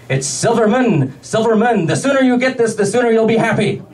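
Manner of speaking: exasperated, drawing out the repeated name; exasperated correction. Retold or imitated. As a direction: correct him with mounting impatience. Boss corrects his name, exasperated.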